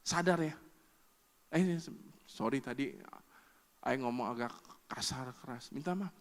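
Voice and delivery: soft, low, sheepish apology; regretful apology. Retold or imitated. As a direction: back down gently after the outburst. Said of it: "lima menit saya nyesel". (suami minta maaf dengan suara pelan dan menyesal)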